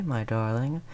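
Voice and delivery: ordinary talk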